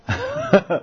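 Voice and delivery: sudden high burst of laughter; squealing giggle. Real, not acted. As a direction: laugh at Krishna's trick. Teller laughs at the scene.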